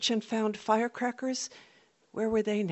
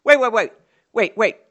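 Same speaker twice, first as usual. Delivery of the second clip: loud urgent calls of wait; loud chasing call; 4 semitones higher, 12 dB louder than usual. Retold or imitated. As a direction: call after her as they chase. Boys chasing, calling out.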